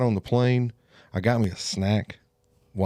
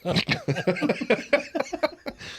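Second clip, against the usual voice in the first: high laugh; 4 dB quieter than usual. Real, not acted. Laughs after pilot voice.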